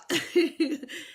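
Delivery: loud high laugh; delighted high laugh; burst of laughter. Real, not acted.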